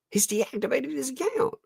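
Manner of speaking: high, faux-puzzled sarcasm; mock innocent. Vlogger mocking the deactivated account, high faux innocence. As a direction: feign innocent surprise.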